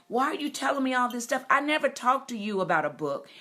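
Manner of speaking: loud, fast, high-pitched protest; retold yell at husband. Yelling at her dying husband in hospice, loud and fast. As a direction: fire it back at him in disbelief. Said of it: "I yelled at my husband".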